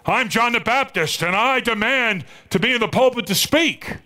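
high, pompous self-proclaimed prophet; intruder's demand. Retold or imitated. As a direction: play the wild-haired man demanding the pulpit. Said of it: "and he says".

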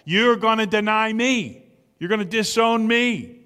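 insistent prediction, punching the final "me"; emphatic "me". Acted. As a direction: drive each sentence hard onto "me". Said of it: "is very emphatic".

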